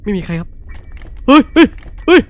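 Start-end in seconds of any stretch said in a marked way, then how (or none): 1.3-2.2 startled yelps. Real, not acted. Startled by the monster.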